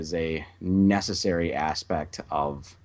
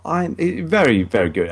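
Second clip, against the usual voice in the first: mock English accent; 7 semitones higher, 8 dB louder than usual. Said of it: "I can't do an English accent very well".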